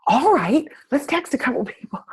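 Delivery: high, breathless whispery squeal; hammed-up squeaky excitement with a laugh; really excited. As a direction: imitate a friend giddily agreeing. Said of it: "she gets really excited".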